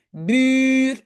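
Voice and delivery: "Bure" with a long held u; "Bure" slowly stretched on its vowel. Shown as a demonstration. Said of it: "moi, j'entends un long U".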